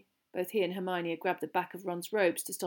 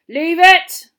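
sharp, very loud, higher shout; shouted order; 10 semitones higher, 19 dB louder than usual. A narrator's reading. Teacher stopping a boy touching the ferret, shouting. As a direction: shout a sudden command. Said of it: "Moody shouted".

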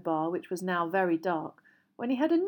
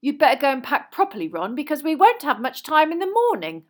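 fast, loud, high motherly order; raised, hurrying instruction; calling down the table; 8 semitones higher, 10 dB louder than usual. A narrator's reading. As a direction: call briskly to a son further off. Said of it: "called down the table".

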